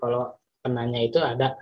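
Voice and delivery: usual speaking voice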